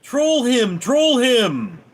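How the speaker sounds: hammed-up jeering troll chant; mock yell. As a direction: egg them on like a jeering troll. Mocking trolls egging each other on.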